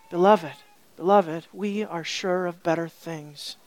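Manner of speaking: low, tender reassurance; gentle. Retold or imitated. Author calming readers after raising the temperature, gently. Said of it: "then he says gently".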